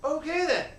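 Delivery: high, loud surprised reaction. Retold or imitated. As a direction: pleasantly caught off guard. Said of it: "we're like".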